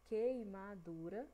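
queimadura slowly split into quei-ma-du-ra; syllable by syllable. Shown as a demonstration. Teacher counting syllables for pupils, queimadura syllable by syllable. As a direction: draw out each syllable of queimadura. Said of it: "que tem quatro sílabas".

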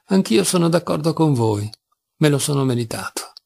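plain reading voice

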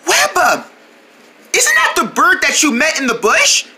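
usual speaking voice

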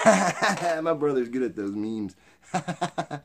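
loud, high laughter through words; laughing. Real, not acted. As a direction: laugh hard while talking. Laughing at brother's meme.

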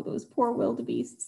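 usual speaking voice

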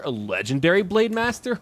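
high, loud, disbelieving; surprised. Real, not acted. Gamer marvelling at a new knight's skill, in loud, high surprise. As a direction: jump up in pitch in amazement.

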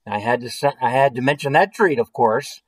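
plain reading voice

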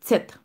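tèt with a crisp ts onset; German letter name. Shown as a demonstration. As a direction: model tèt with ts at the front. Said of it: "m vle nou pwononse li byen". (tèt an alman, ak son ts)

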